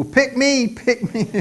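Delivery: high-pitched playful plea to be picked; eager schoolkid. Acted. Pupil in school begging to be chosen, high eager pleading. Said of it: "What do you say in school?".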